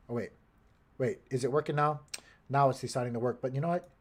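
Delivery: ordinary talk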